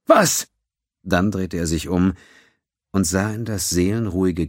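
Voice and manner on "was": higher, louder, irritated snap; snapping. A narrator's reading. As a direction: snap back at her. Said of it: "blaffte zurück".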